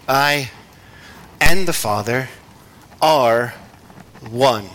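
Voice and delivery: slow, broken into separate pieces with long pauses; slow reading. Shown as a demonstration. Preacher rereading the verse to the congregation, slowly and piece by piece. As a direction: read it slowly, pausing after each part. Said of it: "read that statement again, slowly".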